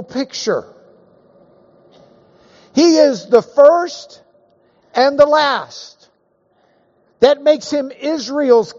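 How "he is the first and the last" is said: high, slow and louder, every word weighted; climactic proclamation. Real, not acted. Preacher proclaims to his class, high and slow. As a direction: lift it as the peak of the sermon.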